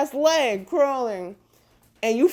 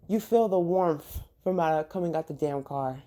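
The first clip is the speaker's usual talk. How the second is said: ordinary talk, nothing marked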